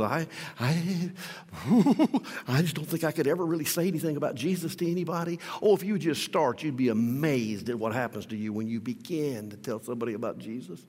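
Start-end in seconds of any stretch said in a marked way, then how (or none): 0.0-5.4 mock timid stammer, softer and rushed. A hint of a nervous believer, stammering softly. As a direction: imitate a nervous believer, lightly. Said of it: "people that just tremble".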